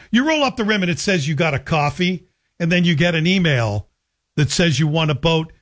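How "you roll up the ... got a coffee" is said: ordinary delivery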